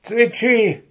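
high, loud shout of the bird's name; shrieked summons from an irate gnome; angry yell. A narrator's reading. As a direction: yell out for the culprit. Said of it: "hörten sie Puh schreien".

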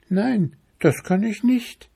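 high-pitched tiny mushroom; squeaky little voice. A narrator's reading. Little chanterelle explaining to the bear, squeaky high voice. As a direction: pipe it up in a small high voice. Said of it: "mit einem so piepsigen Stimmchen".

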